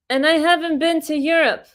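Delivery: mock her condescending dodge; Kamala impression. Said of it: "and she goes".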